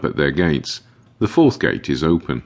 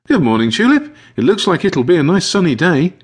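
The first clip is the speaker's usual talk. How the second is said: high, louder, cheerful greeting; chirpy high-pitched morning hello; cheery young caretaker; 14 semitones higher, 5 dB louder than usual. A narrator's reading. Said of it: "she says and chuckles".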